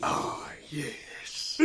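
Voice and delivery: soft breathy whisper. Real, not acted. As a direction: whisper it unvoiced. Whispered reply.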